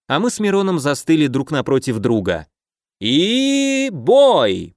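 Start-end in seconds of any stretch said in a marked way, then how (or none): 3.0-4.7 announcer shout. A narrator's reading. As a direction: belt it to the crowd. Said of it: "прокричал Фил". (громкий выкрик ведущего)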